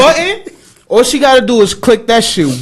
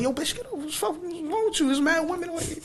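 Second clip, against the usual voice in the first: much softer, hushed urging; urgent hush; 7 semitones higher, 15 dB quieter, faster than usual. Retold or imitated. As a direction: hiss at her to get up quietly. Hushed urging in crowded elevator.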